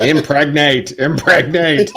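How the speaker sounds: robotic Dalek chant, rising loud. Pretend. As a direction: shout it like a Dalek. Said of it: "Fucking Dalek comes into the room".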